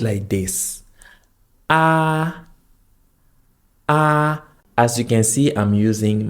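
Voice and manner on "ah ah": Ah held long on a high, level pitch; flat first tone. Shown as a demonstration. Teacher showing learners the first tone, Ah high and flat. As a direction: sustain each Ah high and even, no glide. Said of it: "The first tone is the flat tone".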